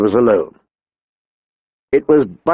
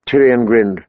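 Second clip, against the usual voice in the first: ordinary delivery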